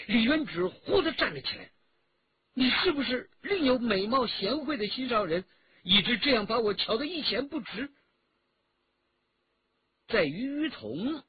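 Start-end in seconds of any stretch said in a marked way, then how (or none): 2.5-7.9 high-pitched jealous reproach; hurt, agitated challenge. A narrator's reading. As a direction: demand an answer, wounded and close to tears. Girl leaps up, jealously confronts him. (激动委屈地高声质问)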